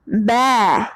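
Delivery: बै said with an open ai and a light ya glide at the end; बै with a faint ya sound at its end; pron demo. Shown as a demonstration. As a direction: open the mouth wide for बै. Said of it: "इसको बोलेंगे".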